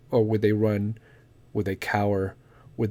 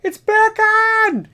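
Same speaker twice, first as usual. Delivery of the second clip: shrill shriek, much higher and louder, dragged out slow; sibling screaming; 5 dB louder, much slower than usual. Retold or imitated. Sibling calls everyone back to the TV, screaming. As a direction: yell it across the house like a frantic kid. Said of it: "two minutes screaming".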